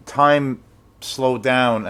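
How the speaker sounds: stretched out very slow, like time dragging; slow-motion words. Retold or imitated. Telling how the moment froze, drawn out very slow. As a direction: let each word crawl. Time slowing at bad news.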